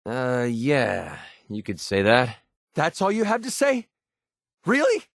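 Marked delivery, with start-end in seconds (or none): 2.7-5.0 high, incredulous rising questions; can't believe what she heard; stunned disbelief. Acted. Companion stunned by the player's cold reply, incredulous.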